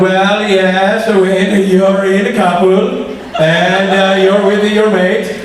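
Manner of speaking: slow, laughing hint of a Swedish accent; Swedish impression. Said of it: "That spot on impression".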